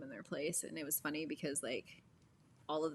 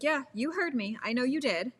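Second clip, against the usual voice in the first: higher, louder retold snark; snarky comeback; 7 semitones higher, 5 dB louder than usual. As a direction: snark right back at the surgeon. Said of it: "and I snarked back at him".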